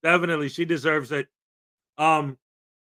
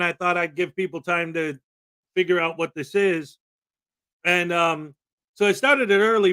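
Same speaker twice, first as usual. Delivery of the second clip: ordinary talk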